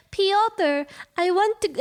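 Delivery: high, put-on Russian accent. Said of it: "baby me doing a Russian accent".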